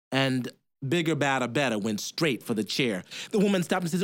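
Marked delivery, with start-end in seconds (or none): none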